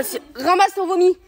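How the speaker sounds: usual speaking voice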